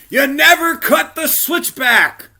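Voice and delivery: slow, high, full-throated yell; group yell. Retold or imitated. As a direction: shout the rule at the top of your lungs. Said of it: "you'll hear our whole group yell".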